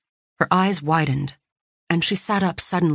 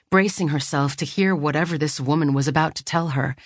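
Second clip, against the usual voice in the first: plain reading voice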